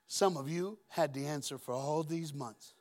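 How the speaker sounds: ordinary talk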